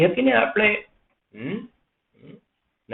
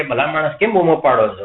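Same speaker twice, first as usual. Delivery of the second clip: ordinary talk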